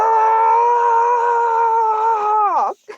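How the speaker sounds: a hammed-up shriek of laughter and despair; laughing cry. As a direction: shark breaks down over SeaWorld, wailing laugh. Shark overwhelmed after SeaWorld rant.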